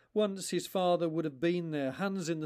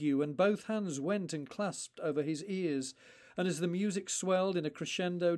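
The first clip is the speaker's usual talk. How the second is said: ordinary delivery, nothing marked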